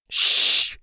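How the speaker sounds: loud unvoiced shush; sharp hush. A narrator's reading. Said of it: "the girl hushed".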